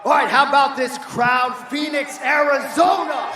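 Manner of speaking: loud, high call to the arena; hyping the crowd. Real, not acted. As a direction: pump up the crowd. Host hyping the live crowd.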